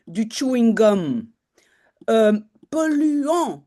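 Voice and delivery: ordinary talk, nothing marked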